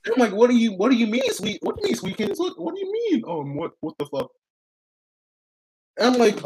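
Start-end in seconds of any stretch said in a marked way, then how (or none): 0.3-4.3 panicked disbelief. Retold or imitated. Retold panic at girlfriend.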